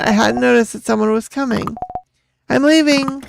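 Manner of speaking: ordinary talk, nothing marked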